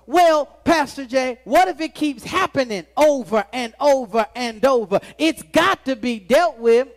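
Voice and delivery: loud, pleading complaint; mock congregant objection. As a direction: call out the objection like a frustrated churchgoer. Congregant's mock objection voiced loudly.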